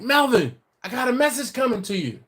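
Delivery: high, loud retold holler; calling out. Customer hailing Melvin at the deli, re-enacted call. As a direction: hail him across the counter.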